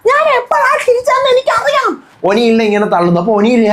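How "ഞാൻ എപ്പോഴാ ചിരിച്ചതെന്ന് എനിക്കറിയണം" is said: shrill-pitched parody of the angry housemate; mocking Rena. Vlogger mocking Rena demanding when she laughed, squeaky high parody.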